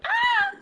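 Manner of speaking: very high-pitched little squeal. Pretend. Off to get the sleeper, playful squeal. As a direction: let out a thin, high squeal.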